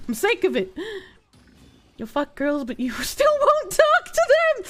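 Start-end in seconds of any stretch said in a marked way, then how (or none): 2.9-4.6 high-pitched squeal through laughter; squeaky, helpless laughing delivery. Real, not acted. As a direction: crack up while saying it. Cracking up at a chat comment.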